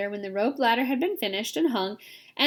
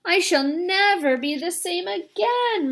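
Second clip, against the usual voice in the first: murmured; 8 semitones higher, 4 dB louder, slower than usual. A narrator's reading. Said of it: "murmured the earthworm".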